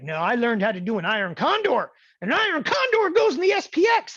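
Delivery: high, loud insistent mock voice; stubborn trader. Teacher mimicking a stubborn student defending iron condors, high and insistent. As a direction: play the know-it-all, shrill and sure. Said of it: "They're like".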